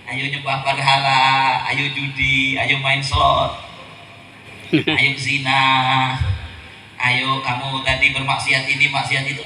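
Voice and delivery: low, drawn-out tempting invitation; devil coaxing. Acted. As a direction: lure them in with a low, steady coax. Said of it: "Saya ajak".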